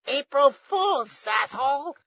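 high, drawn-out granny yell; gleeful loud gotcha from grandma; triumphant shout. A narrator's reading. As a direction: shout it high and slow as you strike. Grandma zaps the ranger.

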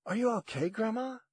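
put-on concerned young woman checking on grandma; worried granddaughter. A narrator's reading. Willow worried about Grandma.

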